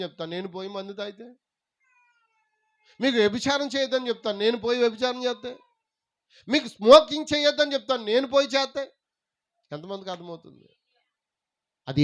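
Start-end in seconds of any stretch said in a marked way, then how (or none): 3.0-8.9 raised, high preaching voice; preacher's challenge. Acted. Preaches at full force. (గొంతు పెంచి నిలదీస్తూ ప్రసంగిస్తూ)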